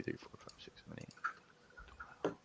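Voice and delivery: counting dice in a whisper. Real, not acted. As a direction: breathe the numbers out. Counting dice under breath.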